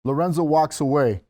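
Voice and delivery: ordinary talk, nothing marked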